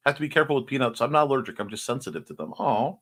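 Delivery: normal talking voice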